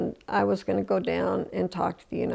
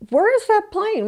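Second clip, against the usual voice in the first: high, louder desperate question; frantic demand; 12 semitones higher, 4 dB louder than usual. Retold or imitated. Daughter demanding answers from the guard about the plane. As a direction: panic lifting the voice sharply.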